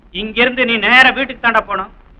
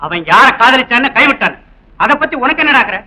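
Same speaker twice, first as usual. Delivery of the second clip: higher and louder, telling him off; angry scolding; 4 semitones higher, 6 dB louder than usual. Acted. Policeman father scolding his son, louder and higher. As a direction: demand to know why he meddles.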